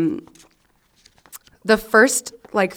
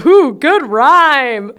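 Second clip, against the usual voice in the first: loud, slow, higher teasing "Ooh, good rhyme"; mock praise; 6 semitones higher, 7 dB louder, much slower than usual. Real, not acted. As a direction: gush over it sarcastically.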